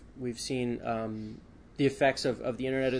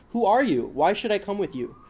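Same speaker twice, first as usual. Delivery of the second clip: high, louder protest with a tinge of fear; crying out, slightly fearful; 8 semitones higher, 7 dB louder than usual. A narrator's reading. Journalist questioning men who came for him, fearful outcry. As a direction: demand answers in a strengthened, alarmed voice. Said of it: "cried out, his voice strengthening with a tinge of fear".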